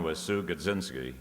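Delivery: ordinary delivery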